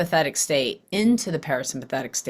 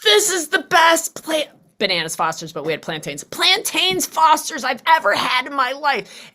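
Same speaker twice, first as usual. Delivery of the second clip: mock rapture; 8 semitones higher than usual. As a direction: swoon over the taste. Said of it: "we're like".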